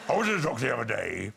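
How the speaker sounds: put-on old-time comic telling a gag; put-on stage voice for a doctor joke; comedian impression. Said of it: "she made me do the impressions".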